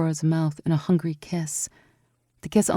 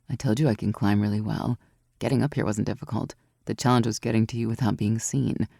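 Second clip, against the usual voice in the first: lower, cool confident voice with a grin; Aurora, mischievous; 6 semitones lower, 3 dB quieter than usual. A narrator's reading. Aurora teasing Evie about her climbing skill, lower and sly. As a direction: drop low and sound smug about the climb.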